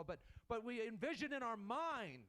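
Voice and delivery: ordinary delivery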